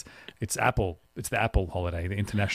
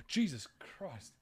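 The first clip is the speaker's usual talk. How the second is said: hushed, slow shocked gasp; scared gasp; 3 semitones higher, 14 dB quieter, much slower than usual. Real, not acted. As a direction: gasp it out in real fright. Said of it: "pretty manly scared voice".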